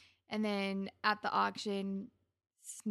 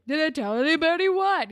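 high, loud, put-on innocent voice; mocking Spinner; 8 semitones higher, 10 dB louder than usual. Spinner feigning ignorance to Marco, loud and high-pitched. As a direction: play dumb in a loud, high, jeering voice.

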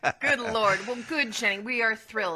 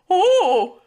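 surprised gasp; 10 semitones higher, 9 dB louder than usual. Real, not acted. Gasp at the fox.

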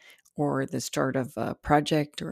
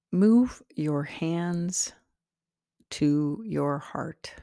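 guided meditation. Acted. Meditation host leading listeners into their heart center, slowly and calmly. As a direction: guide them gently inward, unhurried and spacious.

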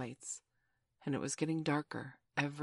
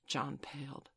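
ordinary delivery, nothing marked

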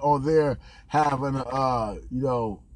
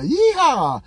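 high, loud yeehaw; mock cowboy whoop; 8 semitones higher, 6 dB louder than usual. Comic mocking make-America-great voters, cowboy whoop.

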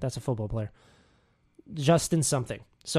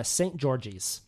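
flat Americanised "Saint George's" said as saint GEORGE-iz, slowly; deliberately anglicise "Saint George's"; uneducated American pronunciation. Shown as a demonstration.

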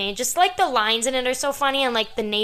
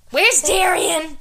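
higher, slow, louder movie line; movie quote voice; 7 semitones higher, 5 dB louder, much slower than usual. Retold or imitated. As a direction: act out the film line. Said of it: "here's some good quotes from the movie".

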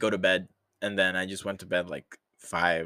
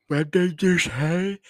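put-on slow, high creaky old man; 9 semitones higher, 8 dB louder, slower than usual. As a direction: play a startled old man asking. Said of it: "my old man voice".

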